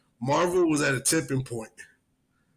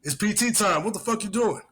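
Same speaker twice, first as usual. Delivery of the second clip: retold urgent call; 8 semitones higher than usual. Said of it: "And I was like".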